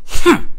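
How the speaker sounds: sharp high, loud huff; sulky indignant "hmph"; pissed-off huff. Acted. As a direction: huff loudly in a sulk. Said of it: "I was so pissed off".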